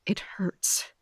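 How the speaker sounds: a soft, breathy whisper of hurt; hushed pain. Acted. Captain admits to herself that losing Leah hurts, whispered. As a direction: let it slip out barely voiced. Captain's private inner confession.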